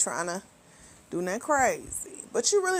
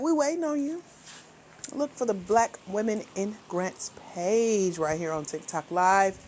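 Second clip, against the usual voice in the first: usual speaking voice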